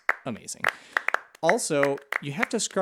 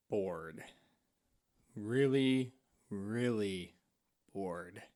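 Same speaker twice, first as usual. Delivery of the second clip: bored; 5 semitones lower, 8 dB quieter, much slower than usual. Acted. Drags out how bored they are.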